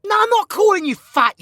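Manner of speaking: high defensive protest. Acted.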